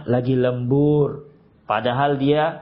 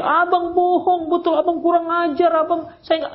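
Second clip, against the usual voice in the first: screaming wife. Retold or imitated. Wife yelling at her lying husband, high mock shriek. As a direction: wail the accusation like an angry wife. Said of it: "Nggak usah teriak-teriak".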